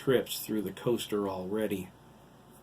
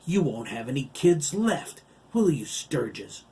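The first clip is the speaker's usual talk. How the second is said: raised, louder villainous taunt; sneering giant; 5 semitones higher, 7 dB louder than usual. A narrator's reading. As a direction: taunt the parent with menace. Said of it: "Jolly Giant Hiram sneered down".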